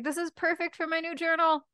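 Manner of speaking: put-on high-pitched thrilled girl; excited squeal. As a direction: squeal with delight over a gift. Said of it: "like, squealing. She's so excited".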